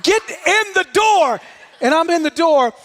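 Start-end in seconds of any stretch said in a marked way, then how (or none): 0.0-1.4 raised, forceful command to one trainee; instructor's order. Retold or imitated. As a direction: order him sternly, building to a shout. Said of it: "he looks directly at me".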